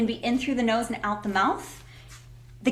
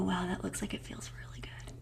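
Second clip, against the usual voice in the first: hushed, awed whisper; 2 semitones lower, 18 dB quieter than usual. Retold or imitated. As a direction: breathe it out softly. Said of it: "they go".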